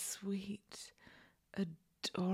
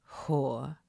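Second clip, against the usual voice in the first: menacing taunt; 8 semitones lower than usual. Acted.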